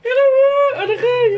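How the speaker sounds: high loud pretend panic; mock fright. Girl about to be bitten fakes loud high fear. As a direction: play-act being scared.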